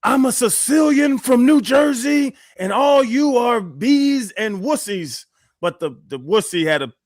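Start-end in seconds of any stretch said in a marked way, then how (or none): 0.0-5.2 high, drawn-out mock yell; imitated yelling. Passenger waking the plane with his rant, yelling. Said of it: "I wake up to a dude yelling".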